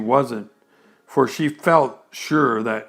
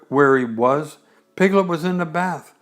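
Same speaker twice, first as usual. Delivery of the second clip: ordinary delivery, nothing marked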